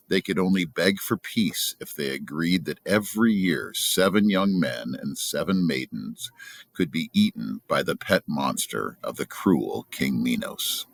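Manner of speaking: ordinary delivery, nothing marked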